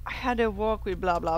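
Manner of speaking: ordinary talk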